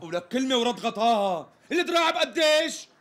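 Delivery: climbing to a high, impatient burst; exasperated demand. Acted. Customer cuts off the tailor's pieties, exasperated. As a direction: cut through the stalling.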